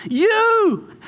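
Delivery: high, loud single-word cry; emphatic cry. Real, not acted. Pastor crying out to the congregation, loud. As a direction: shout the word out to them.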